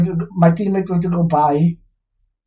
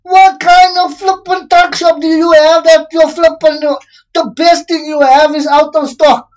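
angry outburst; 6 semitones higher, 4 dB louder than usual. Real, not acted. Gamer yelling at an in-game shop, angry. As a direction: blow up at the shop, high and loud. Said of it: "I was just angry".

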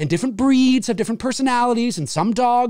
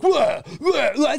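startled pedestrian; 5 semitones higher, slower than usual. Retold or imitated. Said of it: "the pedestrian's like".

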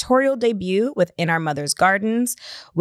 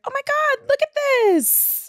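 excited discovery. Retold or imitated. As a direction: retell a gushing, high-pitched reaction. Acted excited reaction to podcast.

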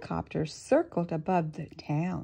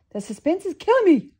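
higher, louder, fast frantic rush; frantic cry; 11 semitones higher, 9 dB louder, faster than usual. Acted. Krabs frets to the crowd in a high, fast cry. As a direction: blurt it out, can't wait. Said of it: "cried Mister Krabs".

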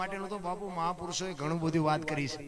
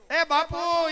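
raised high call to a passing rider; 8 semitones higher, 7 dB louder, much slower than usual. Retold or imitated. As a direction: hail him from a distance. Merchant calling out to rider.

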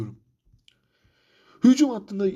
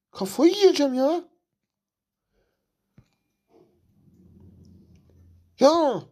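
frustrated outburst; 8 semitones higher, 3 dB louder, slower than usual. Real, not acted. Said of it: "Şu an gerçekten sinirliyim".

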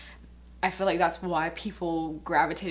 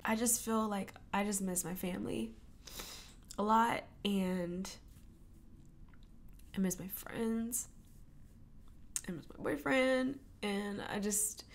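soft, halting, near-whispered crying; tearful; 8 dB quieter than usual. Real, not acted. Vlogger missing family and friends, softly crying. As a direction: choke the words out through tears. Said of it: "not be all emotional and cry".

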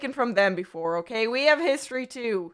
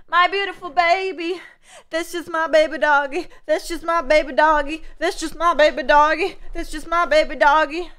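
put-on higher, louder doting baby voice; cooing baby talk; 5 semitones higher, 6 dB louder than usual. Streamer coos over a baby doggy pet, higher doting baby talk.

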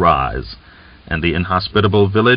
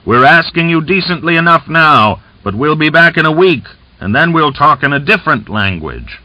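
louder, higher call to the crowd; crying out; 8 semitones higher, 4 dB louder than usual. A narrator's reading. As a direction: call out over the dispersing crowd. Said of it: "he cried".

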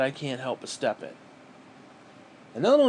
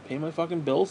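normal talking voice

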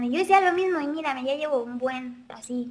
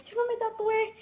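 high, singled-out mocking mom voice; imitated happy mother; 9 semitones higher than usual. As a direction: mimic a gleeful mom who got away with it. Imitates mother happy, not tattooed. (mamá feliz imitada, voz aguda)

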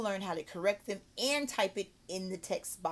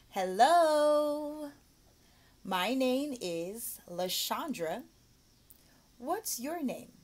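put-on drawn-out, higher-pitched hello to a young pupil; teacher greeting a child; 5 semitones higher, 4 dB louder, much slower than usual. Shown as a demonstration. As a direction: greet a little learner slowly, word by word.

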